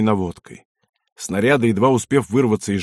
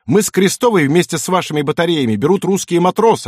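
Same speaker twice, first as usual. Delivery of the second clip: loud, raised angry voice; yelling; 6 semitones higher, 4 dB louder than usual. A narrator's reading. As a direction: shout him down. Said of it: "заорал".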